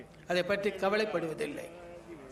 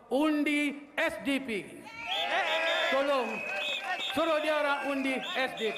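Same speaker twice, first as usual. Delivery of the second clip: rally shout; 4 semitones higher, much slower than usual. Real, not acted. Calling out vote for SDP.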